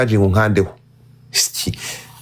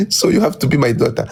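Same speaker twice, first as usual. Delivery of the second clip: ordinary talk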